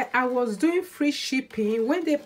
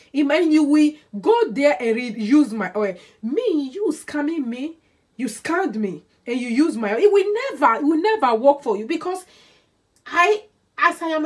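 louder, higher indignant scolding; indignant outburst; 3 semitones higher, 6 dB louder than usual. Real, not acted. Seller scolding scammers who used her oil, louder and indignant. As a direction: tell the scammers off, rising up.

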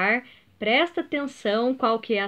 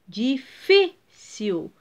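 "Difícil" very slow, three separate syllables, middle "fí" strongest; penultimate stress; much slower than usual. Shown as a demonstration. Teacher showing pupils the middle syllable, stressing "fí" in slow "Difícil". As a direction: break up "Difícil" and punch "fí". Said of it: "o fi é a sílaba tônica".

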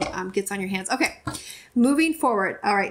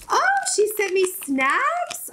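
high, drawn-out excited squeal; excited surprise; 9 semitones higher, slower than usual. Real, not acted. YouTuber discovering snacks in a friend's parcel, excitedly surprised. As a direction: stretch it out, pitch way up.

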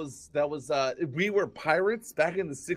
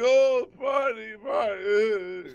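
drawn-out imitated wail, higher and louder; mock sobbing; 6 semitones higher, 3 dB louder, much slower than usual. Mimicking the crowd weeping over Barney, mock wail. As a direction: wail the name like a crowd in tears. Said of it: "everybody started sobbing over Barney".

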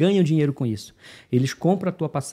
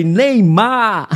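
laughing Neymar; 7 semitones higher, 8 dB louder than usual. Retold or imitated. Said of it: "Aí eu falei".

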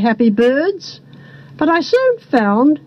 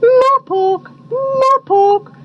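high two-note owl call; boobook owl cry; 11 semitones higher, slower than usual. A narrator's reading. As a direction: hoot the words like a boobook. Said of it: "cried the boo-boo owls".

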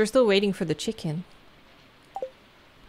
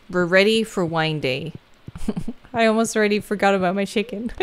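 ordinary talk, nothing marked

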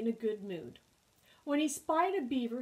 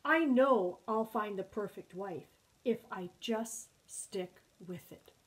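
usual speaking voice

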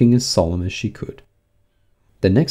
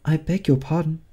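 high, small apologetic voice; Alice meekly begging pardon; very humble; 8 semitones higher than usual. A narrator's reading. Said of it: "said Alice very humbly".

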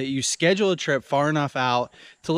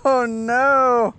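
dismayed; 10 semitones higher, 6 dB louder, much slower than usual. Real, not acted. Fish escapes.

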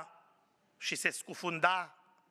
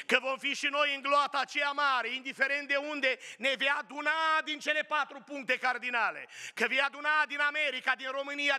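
full-force preaching; 6 semitones higher than usual. Acted. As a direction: lift the crowd with each phrase. (predică înflăcărată, mai sus și mai tare)

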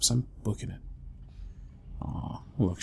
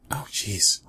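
ordinary delivery

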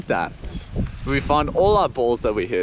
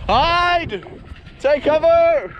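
urgent calling out; 12 semitones higher, slower than usual. Real, not acted. Calling out warning.

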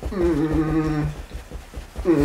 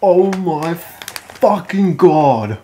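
pained groan; 2 semitones higher, 5 dB louder, much slower than usual. Real, not acted. Burning mouth from hottest chip.

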